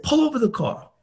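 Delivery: high-pitched, heated command; mock-angry order, pitched well up; retold angry order. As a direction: imitate an agitated demand to stop. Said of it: "a guy in rage".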